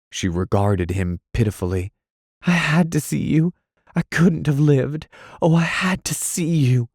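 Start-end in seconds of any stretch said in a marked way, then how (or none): 2.5-6.9 higher pleading woman's voice. Acted. Dot pleading with angry Anthony, higher desperate voice. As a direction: beg him to understand.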